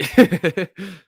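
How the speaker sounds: amused high-pitched chuckling; high giggle. Real, not acted. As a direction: giggle loudly at your own joke. Laughs at own imagined reply.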